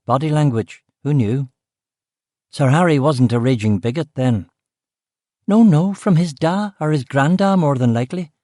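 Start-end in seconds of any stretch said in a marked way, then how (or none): 5.5-8.3 put-on lightly higher Belfast accent; offhand Belfast cook. Cook explaining the trophies, offhand, in a Belfast accent.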